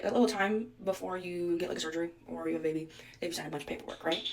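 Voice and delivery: usual speaking voice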